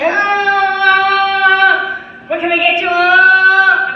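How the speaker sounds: high, loud drawn-out hollers; over-the-top pumped-up waiter, belting it out; psyched-up server. Acted. Restaurant server psyching up for customers, hollering excitedly. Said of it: "fucking psyched up".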